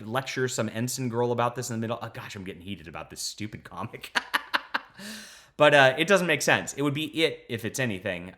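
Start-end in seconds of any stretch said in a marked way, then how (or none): 4.2-5.2 laughing at himself, much higher; a sudden high laugh after ranting; high burst of laughter. Real, not acted. Catching himself ranting to viewers, real high laugh. Laughs at own heated rant.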